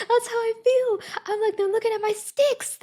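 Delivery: high playful bragging voice; high mock pride. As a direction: squeak it out, pleased with yourself. Voicing her smug pride.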